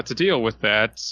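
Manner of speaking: ordinary delivery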